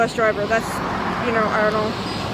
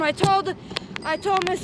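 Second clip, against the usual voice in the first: ordinary delivery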